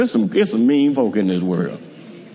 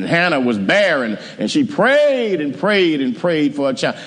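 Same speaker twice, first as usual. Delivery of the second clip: ordinary delivery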